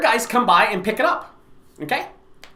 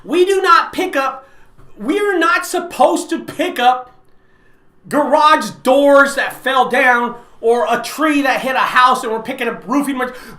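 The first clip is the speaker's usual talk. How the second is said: ordinary delivery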